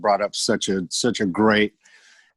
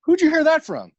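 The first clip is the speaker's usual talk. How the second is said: put-on much higher, louder, amazed kid; surprised kid; 5 dB louder than usual. Boy amazed at where his dad's advice came from, high and loud. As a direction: pitch right up in boyish astonishment. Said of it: "And I went".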